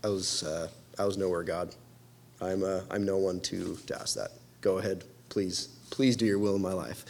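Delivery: bow your head and barely speak; humbled whisper. Acted. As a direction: Job answering God in a hushed humble voice. Job humbly answers God.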